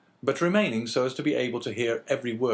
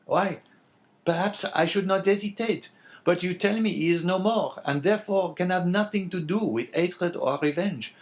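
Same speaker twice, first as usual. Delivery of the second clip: higher-pitched innkeeper character voice; lightly voiced Caderousse; 9 semitones higher, 4 dB louder than usual. Innkeeper answering the abbé, lightly voiced character. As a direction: lightly play the talkative innkeeper.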